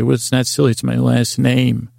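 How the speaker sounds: normal talking voice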